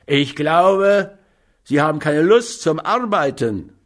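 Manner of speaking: loud, high snarl at the beggar. A narrator's reading. Man eating pie snarls at the beggar at his door, loud and high. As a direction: snap it out with contempt. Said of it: "mit einem schnarrenden".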